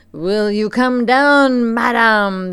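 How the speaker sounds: loud, slow furious demand; in a rage. A narrator's reading. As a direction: roar it at her in fury. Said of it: "in a greatest of rage".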